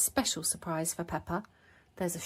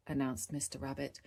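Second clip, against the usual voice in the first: ordinary delivery, nothing marked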